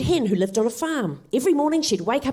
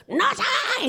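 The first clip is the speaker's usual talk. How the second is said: high-pitched cartoon duck; 14 semitones higher than usual. Duck refusing the little red hen, high-pitched voice. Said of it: "said the duck".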